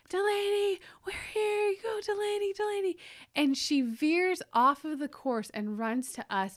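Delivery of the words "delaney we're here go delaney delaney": high, yelled cheering from the sidelines; screaming cheer. Retold or imitated. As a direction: scream her name to cheer her on. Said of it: "we start screaming".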